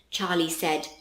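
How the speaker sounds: plain reading voice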